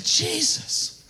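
whispered, breath-only call to Jesus; hushed cry out. Acted. Preacher voicing lepers calling on Jesus, hushed cry out. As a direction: call out under your breath.

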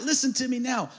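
usual speaking voice

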